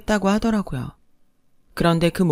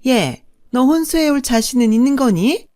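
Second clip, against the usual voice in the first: higher, louder swooping sneer; haughty second mother-in-law, scornful; 4 semitones higher, 4 dB louder than usual. Acted. Second mother belittling the bride's parents, haughty and scornful. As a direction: look down on them with sing-song disdain.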